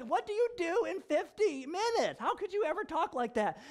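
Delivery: high, lightly voiced disbelief; mild mock astonishment; incredulous friend. Friend marvelling at fifty-minute sermons, mild disbelief.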